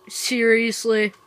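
a loud, slow, drawn-out seriously; indignant disbelief. Real, not acted. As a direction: drag it out, fed up with being trolled. Annoyed at an empty fridge.

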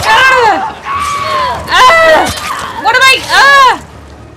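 high panicked shrieks; frightened screams. Real, not acted. Player attacked, screaming.

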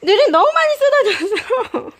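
laughing. Real, not acted. Laughing at spilled treats.

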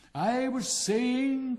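loud, high, very drawn-out words; grandly reclaim the floor, stretching each word; pompous resumption. A narrator's reading. Rocket resuming after being interrupted, loud and pompous.

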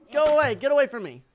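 loud, high warding-off cry; urgent shout. Real, not acted. Gamer to approaching opponent, urgent shout. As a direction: shout to drive them back.

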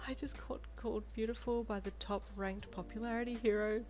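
high, breathless giddy whisper; excited whisper. A narrator's reading. You whisper giddily to Deku, excited whisper. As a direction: whisper it, thrilled. Giggling fan whispers to date.

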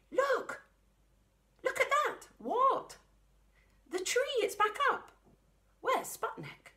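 put-on a child gaping at the tree standing again; astonished child. Child sees tree back up.

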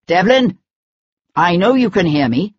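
lightly projected call to someone hidden. A narrator's reading. Pursuer calls after the hidden fugitive, projected, restrained call.

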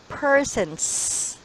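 "persons" with a long, clear final s; "persons" ending in a drawn-out s; final S sounded. Shown as a demonstration. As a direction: say "persons", holding the hiss of the last S. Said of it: "S, with S".

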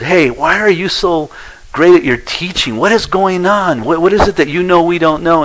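high, excited questioning voice; voicing amazed fellow teachers; eager curious teachers. Teachers eagerly asking about his teaching.

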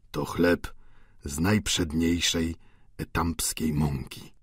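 low, subdued reply; meek old Goriot. A narrator's reading. Old Goriot answers landlady.

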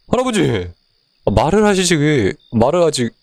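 high, exasperated outcry; fed up with the silence; frustrated calling. Real, not acted. Grandpa won't answer. (대답 없는 할아버지에게 답답해하기)

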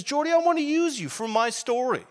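Jesus rallying him to join the story; a hint of Jesus pumping him up. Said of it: "And he says".

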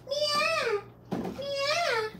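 high, soft cat meows; mewing to the cats, high and soft. Pretend. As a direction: meow like a kitten. Imitating a cat.

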